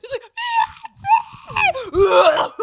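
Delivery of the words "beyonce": high, loud scream of the name; screamed fan reaction. Retold or imitated. Retelling a friend's screaming at the concert, screamed. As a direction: imitate a fan screaming herself into coughs. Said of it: "She screams so hard and so loud".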